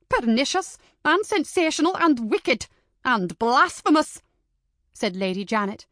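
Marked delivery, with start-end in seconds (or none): 0.1-4.2 louder and higher, every word condemned; indignant denunciation. Acted. Lady Janet denounces the book to Missus Chambers, loud and indignant. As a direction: hammer each charge home.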